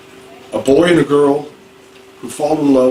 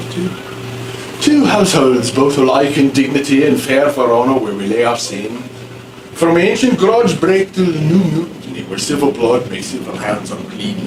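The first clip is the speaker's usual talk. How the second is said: put-on old Elizabethan English accent, sounding a bit Irish; a rough try at an Elizabethan English accent. Shown as a demonstration. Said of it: "That's what it sounds like, sort of".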